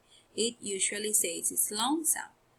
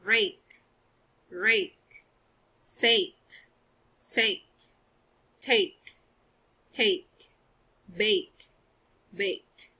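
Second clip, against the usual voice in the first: louder, clear repeated words; long vowel drill; 4 dB louder, much slower than usual. Shown as a demonstration. Teacher drilling pupils on long a words. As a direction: sound each long vowel out for the class. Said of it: "It's long sound".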